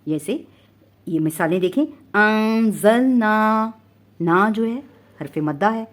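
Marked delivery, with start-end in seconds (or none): 1.8-3.7 अंज़लना very slow and loud, final naa held; madd ending. Shown as a demonstration. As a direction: recite अंज़लना higher, stretching nasal an and last aa. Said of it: "ऐसे का ऐसा ही पढ़ा जाएगा". (अंज़लना, आख़िरी मद्दा खींचकर)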